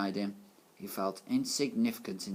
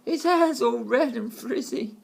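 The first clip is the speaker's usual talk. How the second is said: much higher, louder, slow mocking cry; jeering children; 14 semitones higher, 8 dB louder, slower than usual. A narrator's reading. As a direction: shout the taunt like a kid. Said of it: "Others cried".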